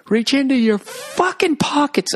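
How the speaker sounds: very high, slow chanted collection plea; sing-songy preacher. Acted. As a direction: stretch it out high like a parody preacher. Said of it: "that stupid sing-songy stuff".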